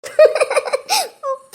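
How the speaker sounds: bright, high embarrassed giggle; a playful giggle at her husband's teasing; shy laugh. Acted. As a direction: laugh off his flirting. Teasing banter between lovers.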